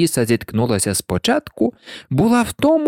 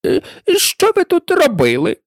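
high-pitched demanding question; shrill royal questioning; imperious queen. A narrator's reading. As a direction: interrogate them as a haughty queen. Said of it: "вона продовжувала".

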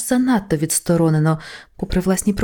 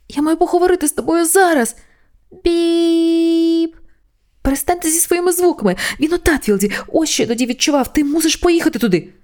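much higher, frantic pleading; panicked; 11 semitones higher than usual. Acted. Angel frantically talking over the answering machine, panicked.

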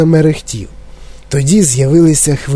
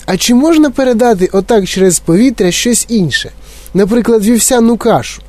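lightly voiced eager boy, higher; 7 semitones higher than usual. Mike pestering Wonka with questions, eager. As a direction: ask it like a pushy kid.

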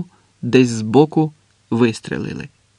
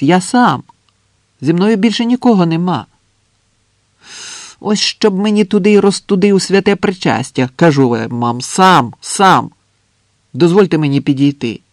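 higher, louder insistent protest; exasperated calling out; 5 semitones higher, 4 dB louder than usual. Acted. Andres insisting he is alone, exasperated shouting. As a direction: swear and insist up at the trench.

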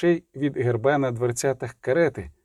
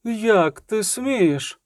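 high, very slow outrage; splutter, aghast at the insolence; drawn-out, scandalised matron; choking indignation; 8 semitones higher, much slower than usual. A narrator's reading. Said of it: "аж захлинулася Матрона".

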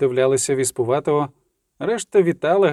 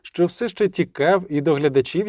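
usual speaking voice

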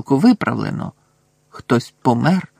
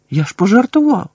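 higher, incredulous burst; amazed exclamation; 8 semitones higher, 4 dB louder than usual. A narrator's reading. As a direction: blurt it out in disbelief, higher. Harry amazed the joke worked.

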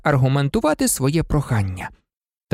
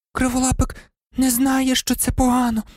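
trembling voice; 10 semitones higher than usual. A narrator's reading. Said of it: "тремтячим голосом озвалася Герміона".

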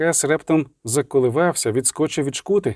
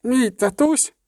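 put-on much higher, piping little-boy voice; crowing child; 10 semitones higher, slower than usual. A narrator's reading. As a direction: crow it out like a small boy. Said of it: "раптом прокукурікав Еверет". (дитячий кукурікаючий голосок)

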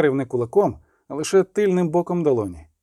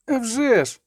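higher eager exclamation; boy's exclamation; 9 semitones higher than usual. A narrator's reading. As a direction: burst out agreeing. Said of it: "крикнув хлопець".

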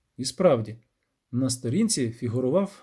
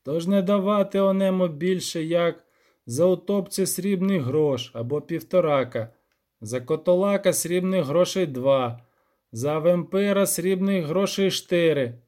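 higher, measured archaic recitation; lightly sing-song granny reader; old granny reading aloud; 4 semitones higher, 3 dB louder than usual. Acted. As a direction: recite an old book with drawn-out phrases. Granny reads the old book aloud.

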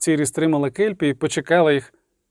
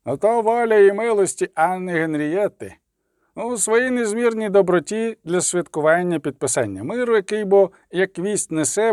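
high, measured official reading; herald's proclamation; 4 semitones higher than usual. A narrator's reading. Official reading the princess's pardon, high proclamation. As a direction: proclaim the decree in a raised pitch.

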